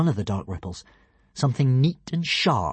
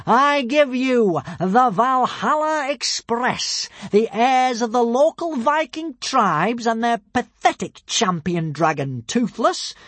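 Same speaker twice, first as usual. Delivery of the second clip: high, slow proclaiming villain; villain shouting; 12 semitones higher, 5 dB louder, slower than usual. A narrator's reading. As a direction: announce it to the crowd, sneering. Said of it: "yelled Alvin the Treacherous".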